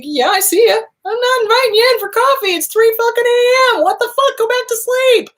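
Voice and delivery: high and loud, shooing off an unwelcome 3 a.m. thought; brushing off the thought. Pretend. Speaker to her own 3 a.m. worry, high, loud brush-off. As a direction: send the intruding thought back to bed, high and loud.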